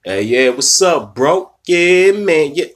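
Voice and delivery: mock his switched-up swagger, loud and drawn out; put-on swag. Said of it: "He changed his whole swag up".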